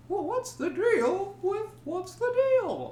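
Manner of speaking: high, slowed stand-up voice; hammy observational comic; Seinfeld impression. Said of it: "you could do anything".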